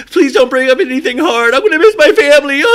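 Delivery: high, loud, fast whiny wail; mock crying Paige. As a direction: ham up tearful pleading. Mocking Paige's tears.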